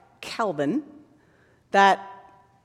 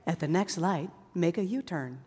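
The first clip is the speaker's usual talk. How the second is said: calm GPS voice; 4 semitones lower, 9 dB quieter than usual. Retold or imitated. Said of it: "It may say".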